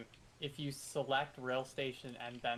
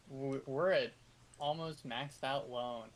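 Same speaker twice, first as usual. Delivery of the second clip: normal talking voice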